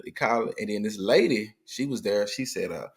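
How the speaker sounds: ordinary delivery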